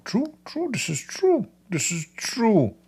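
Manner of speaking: squeaky high voice, mocking; high mock agreement. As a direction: chirp it high and teasing.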